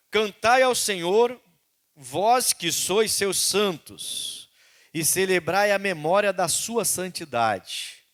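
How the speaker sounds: a measured, solemn Bible reading; slow scripture reading. Acted. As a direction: read the psalm slowly and solemnly. Reading the psalm aloud.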